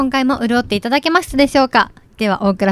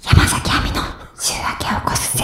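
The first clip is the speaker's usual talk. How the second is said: whispered title; 4 dB quieter than usual. Acted.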